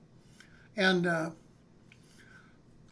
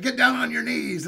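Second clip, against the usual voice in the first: commanding; 4 semitones higher, 6 dB louder than usual. Retold or imitated. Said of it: "And he said".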